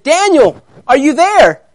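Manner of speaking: loud, high call into the pit. Acted. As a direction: call anxiously for someone far below. King calls into the pit.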